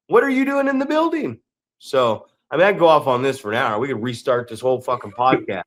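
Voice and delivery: ordinary talk, nothing marked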